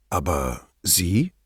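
low, slow admiring question; full of admiration. A narrator's reading. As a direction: draw it out in quiet awe. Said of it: "fragte Brunetti voller Bewunderung". (voller Bewunderung, tief und langsam)

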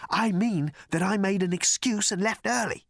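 high-pitched, puzzled explanation. A narrator's reading. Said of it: "said the man with a puzzled frown".

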